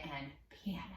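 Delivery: whispered piano, soft as can be. Shown as a demonstration. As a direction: breathe the word out. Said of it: "this song is gonna have some forte".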